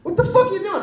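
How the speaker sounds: high, exasperated dad yell; a dad snapping at his son, high and exasperated; mock angry dad. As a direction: bark at the kid like a fed-up dad. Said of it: "He'd say".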